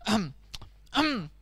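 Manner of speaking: little preparatory "ahem"; self-important ahem; throat clearing. Acted. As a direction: clear the throat before a performance. Donkey clears throat before singing.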